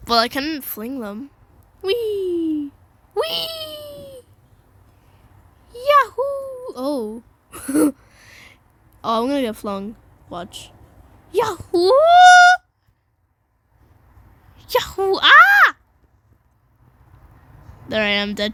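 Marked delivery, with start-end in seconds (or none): 1.8-4.2 high, drawn-out whee; playful whee. Pretend. Flinging characters about. 5.7-6.7 high-pitched yahoo whoop; playful whoop. Pretend. Launching in the game. 11.3-15.2 high yahoo whoop; playful whoop. Pretend. Launching in the game. 15.2-15.8 high, loud scream. Real, not acted. Scream after being launched.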